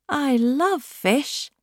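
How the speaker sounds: high, slow and delighted; a happy pelican savouring the word fish; happily. A narrator's reading. Pelly delights in the river's fish, high and slow. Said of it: "said the Pelly happily".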